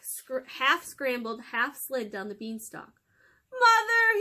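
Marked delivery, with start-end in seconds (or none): 3.5-4.2 loud, very high shout; yelled call. Acted. Jack yelling to his mother for help, loud and high. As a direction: yell down to mother. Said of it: "he yelled".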